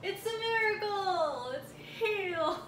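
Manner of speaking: mock miracle. Mock healing exclamation.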